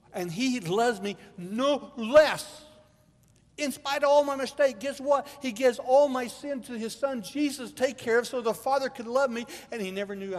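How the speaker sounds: high and loud, climbing with conviction; full-force preaching. Acted. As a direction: shout the good news to the church.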